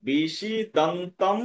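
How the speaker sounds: বিষিদন্তম drawn out syllable by syllable; very slow model of বিষিদন্তম; demonstrated pronunciation. Shown as a demonstration. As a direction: sound out বিষিদন্তম slowly for learners. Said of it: "উচ্চারণটা কি করবো আমরা?".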